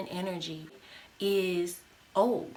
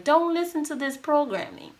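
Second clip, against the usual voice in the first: higher, louder rebellious inner voice; inner rebel voice; 7 semitones higher, 4 dB louder than usual. Retold or imitated. Inner rebel urging the listener to defy programming, higher and louder. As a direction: play the defiant little rebel inside. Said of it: "that little rebel in you that's like".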